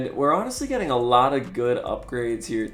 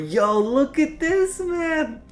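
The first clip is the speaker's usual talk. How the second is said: amazed; much slower than usual. Real, not acted. Amazed at game scenery.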